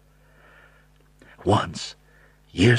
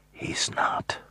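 whisper; 5 dB quieter than usual. A narrator's reading. Quiet stubborn denial.

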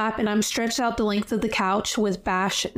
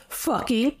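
proud; 3 semitones higher than usual. Retold or imitated. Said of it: "She says it, like, all proud".

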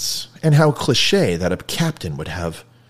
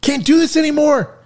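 much higher, fed-up shout; frustrated outburst; 4 dB louder than usual. Retold or imitated. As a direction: blow up like a man at his limit. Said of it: "he's just like".

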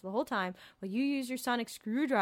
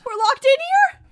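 high loud alarmed question; 13 semitones higher, 11 dB louder than usual. Acted. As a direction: panic at being trapped. Alarmed at being trapped.

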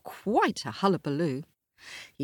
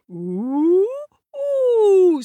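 much higher, louder whooping ooh; rising ooh; 10 dB louder than usual. A narrator's reading. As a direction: soar up with the kick. Charles flying out.

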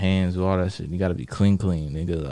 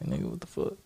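low, hushed disbelief; 8 semitones lower, 12 dB quieter than usual. Retold or imitated. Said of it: "but I'm like".

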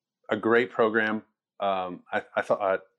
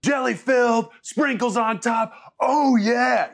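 much higher and louder, hyped shout; fired-up coach; 12 semitones higher, 4 dB louder than usual. Retold or imitated. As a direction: explode with delight like a coach after a perfect throw. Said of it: "And he goes".

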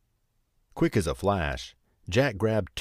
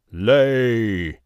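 much louder barked command; bellowed; 3 semitones higher, 9 dB louder than usual. A narrator's reading. Giant ordering his hen to lay, loud bellow. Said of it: "he bellowed".

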